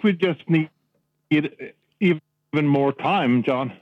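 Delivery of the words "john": ordinary delivery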